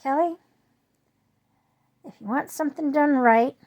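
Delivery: high, loud call of a name; a lightly voiced grandfather's advice; quoting grandfather. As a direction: grandfather advising Kelly. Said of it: "grandfather used to always say".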